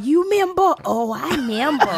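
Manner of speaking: high squeaky berry voice; tiny high cartoon fruit voice; Member Berries voice. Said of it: "they're all just in there going".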